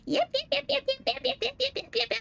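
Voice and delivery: high, mocking repeated yeps; nagging inner voice. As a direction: chirp it like a taunting voice. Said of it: "that negative voice is going".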